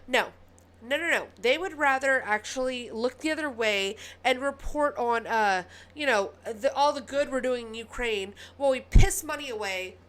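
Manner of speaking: loud, heated rant at the news media; very annoyed. Real, not acted. Ranting to viewers about media ignoring Nebraska, annoyed and loud. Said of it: "I'm getting very annoyed".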